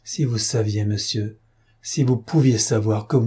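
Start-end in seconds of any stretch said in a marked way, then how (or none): none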